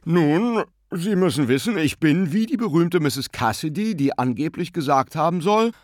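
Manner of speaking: high, hearty gentleman's voice; mock-genial pose. A narrator's reading. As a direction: play the overfamiliar charmer. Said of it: "biedernder Verstellung".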